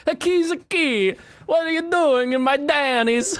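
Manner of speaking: very high cartoon Italian accent; hammed-up Italian, squealed and stretched out; mock Italian. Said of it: "If you're Italian, you say it like this".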